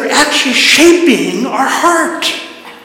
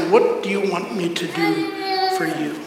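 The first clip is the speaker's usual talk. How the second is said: soft question; 9 dB quieter than usual. Acted. Jesus asking you, softly.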